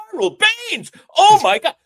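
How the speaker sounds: high, loud starstruck shriek; excited fan scream. Acted. Fan spotting a ballplayer in the bathroom, screaming his name. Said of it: "not to scream the guy's name out".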